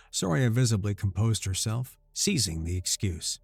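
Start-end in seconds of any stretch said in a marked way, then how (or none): none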